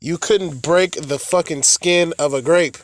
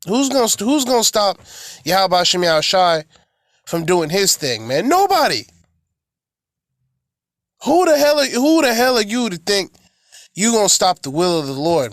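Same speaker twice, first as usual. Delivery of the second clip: fiery preaching; 3 semitones higher than usual. Acted. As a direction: hammer the question home from the pulpit. Preacher rebukes Esau.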